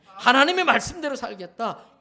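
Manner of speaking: loud, firmly preached vow; resolute declaration. Acted. Preacher voicing a believer's resolve to live by God, resolute and loud. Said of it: "결단하고 살아야 됩니다".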